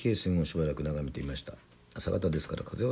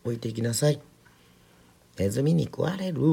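put-on higher-pitched call after a child; teacher's raised-pitch warning; 6 semitones higher than usual. A narrator's reading. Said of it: "花子先生が声をかけました".

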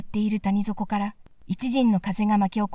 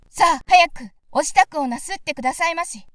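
higher and louder, dogs urging the princess; dogs speaking; 6 semitones higher, 3 dB louder than usual. Acted. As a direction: yap the words out like eager hounds. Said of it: "と吠えました". (犬が急かすように高く強く)

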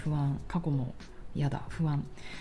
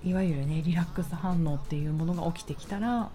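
guided meditation. Acted. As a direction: guide them gently into calm. Leads a grounding meditation.

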